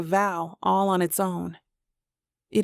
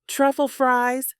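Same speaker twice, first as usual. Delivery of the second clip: confident shout; 6 semitones higher, slower than usual. Acted. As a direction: call it out with total confidence. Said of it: "Zoran shouts confidently".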